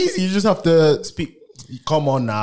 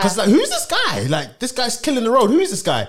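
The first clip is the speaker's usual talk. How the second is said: higher-pitched mock amazement; amazed audience reaction; 8 semitones higher than usual. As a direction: exclaim like a viewer discovering an unknown talent.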